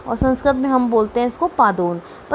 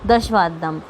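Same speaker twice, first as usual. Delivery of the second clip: low summing-up answer; 7 semitones lower, slower than usual. Shown as a demonstration. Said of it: "समझ में आ गया?".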